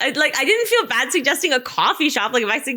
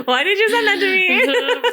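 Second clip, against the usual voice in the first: much higher, slow, laughing; laughing disbelief; 8 semitones higher, 4 dB louder, slower than usual. Retold or imitated. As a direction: laugh out the baffled question. Mock reaction to singing video.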